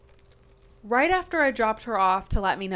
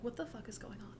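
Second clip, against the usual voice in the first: much softer, under the breath; muttered disbelief; 15 dB quieter than usual. Retold or imitated. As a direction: say it to yourself in shock. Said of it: "And I'm like".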